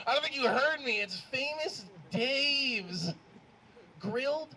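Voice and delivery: high, incredulous comic rant; mock outrage. Comedian protests to crowd about wedding food, outraged. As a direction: pitch it up in exasperated disbelief.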